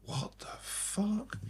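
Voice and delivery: the band staring in mock disbelief; stunned disbelief. Band members reacting to the singer walking off, stunned and disbelieving. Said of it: "the band are all like".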